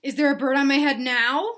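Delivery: a touch of alarm; anxious Gerald. Acted. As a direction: fret about it.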